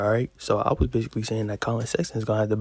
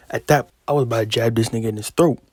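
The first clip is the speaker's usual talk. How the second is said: normal talking voice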